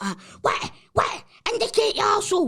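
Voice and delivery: high, loud furious shout at a driver; angry yell. Retold or imitated. Shouting at the driver tooting his horn, furious. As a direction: bellow it across the road. Yelling again as horn toots.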